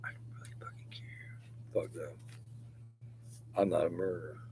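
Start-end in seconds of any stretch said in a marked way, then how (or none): 0.0-1.4 a low hushed whisper; whispered dismissal. Real, not acted. To viewers about critics, a dismissive whisper. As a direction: hiss it under the breath.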